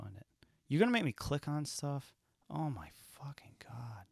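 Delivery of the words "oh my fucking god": whispered curse under the breath; exasperated whisper. Real, not acted. Host stuck scrolling a streaming list, whispered exasperation. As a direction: breathe it out, fed up.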